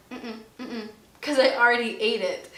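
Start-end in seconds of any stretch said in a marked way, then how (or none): 0.1-0.9 soft closed-mouth mm-mm, shaking it off; quiet guilty hum to her boyfriend, denying the pizza; mock denial hum. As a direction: hum a sheepish no. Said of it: "And I'm like".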